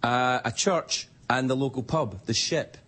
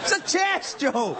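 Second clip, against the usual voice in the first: higher, slow, shouted Scottish brogue; mock Scottish shout; 11 semitones higher than usual. Comic defending his chess pun, shouted Scottish. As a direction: bellow it like an indignant Scot. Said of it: "punchline in Scottish brogue".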